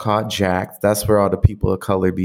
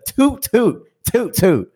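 playful toot; 10 semitones higher, slower than usual. Acted. Mock horn tooting.

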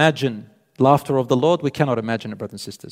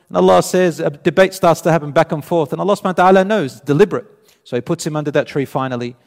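normal talking voice

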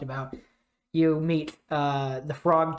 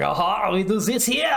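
higher, hammed-up Montreal French accent; overdone Montreal French; 4 semitones higher, 3 dB louder than usual. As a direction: ham up a Québécois French accent. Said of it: "he's overly Montreal French".

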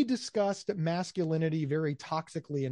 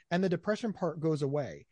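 usual speaking voice

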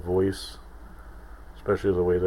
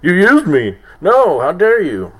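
much higher, loud furious yelling; angry shout; 9 semitones higher, 11 dB louder, slower than usual. Retold or imitated. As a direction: yell it in outrage. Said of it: "And then Batman's like".

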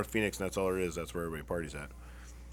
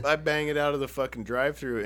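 ordinary delivery

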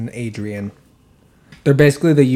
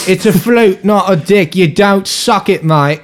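higher, louder mock British bloke; British accent; 7 semitones higher, 5 dB louder than usual. Podcast listener quoting the hosts' funny accents, mock British accent. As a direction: imitate a loud British lad, pitched up. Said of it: "they said it like".

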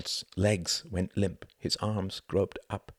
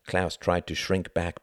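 ordinary delivery, nothing marked

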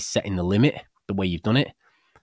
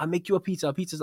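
higher mock Italian accent; imitated Italian chef, pitched higher; 9 semitones higher, faster than usual. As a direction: play the Italian pizza chef, teasing. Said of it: "in an Italian accent".

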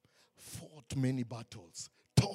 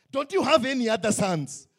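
a hint of an impatient father; high, loud demand for another son; 8 semitones higher, 11 dB louder than usual. Father asking the prophet about his sons, lightly voiced.

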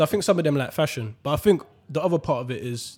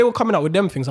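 ordinary talk, nothing marked

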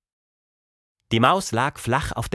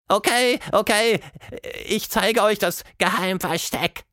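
squeaking; 10 semitones higher than usual. A narrator's reading. The trembling mouse giving in to the friends, high squeak. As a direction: squeak out the surrender. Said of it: "quiekte sie".

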